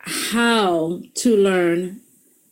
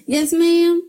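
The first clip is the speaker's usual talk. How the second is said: put-on little girl voice; 9 semitones higher, much slower than usual. Daughter answering her mother, high and eager like a little girl. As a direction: chirp it like an obedient child. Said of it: "turn back into those little girls".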